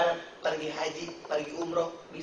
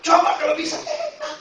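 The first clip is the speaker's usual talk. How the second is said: laughing squeal; 11 semitones higher, 8 dB louder than usual. Real, not acted. Laughing punchline, voice jumps up.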